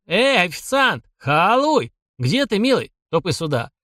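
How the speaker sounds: calling out. Acted. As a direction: summon the waiter across the room. Saburov calls the waiter loudly. (громкий оклик официанту)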